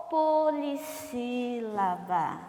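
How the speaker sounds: POLISSÍLABA stretched slowly over every syllable. Shown as a demonstration. Teacher gives pupils the term, "Polissílaba" slowly drawn out. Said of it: "classificamos-a como?".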